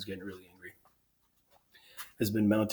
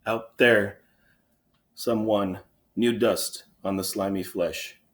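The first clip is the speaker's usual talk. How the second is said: halting, broken phrases with pauses; fumbling for words; 7 dB louder, slower than usual. Acted. Overcome sentry reports the covered body to the king, halting. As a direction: struggle to get each piece out. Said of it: "fumbles with words".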